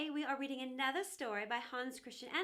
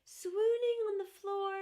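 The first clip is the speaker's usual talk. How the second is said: high, loud dramatic swooning; theatrical swoon; 7 semitones higher, 8 dB louder than usual. A narrator's reading. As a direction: draw it out like a melodramatic faint. Teapot faints after its fall.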